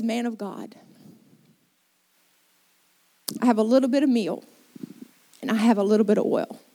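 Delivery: low, heavy voice of a widow with nothing left; resigned despair. Retold or imitated. Widow telling the man of God they will starve, low and resigned. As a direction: let the hope drain out as you list what little remains.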